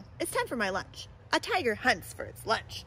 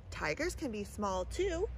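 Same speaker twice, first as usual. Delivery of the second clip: ordinary delivery, nothing marked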